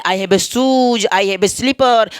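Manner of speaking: drawn-out model sentences; teacher drilling sentences very slowly. Shown as a demonstration. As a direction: stretch each word out for the class to repeat. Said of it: "आपको बोलते रहिए".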